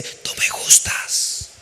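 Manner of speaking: breathy secret whisper, slow and hushed. Retold or imitated. Child's classmate whispering a confession.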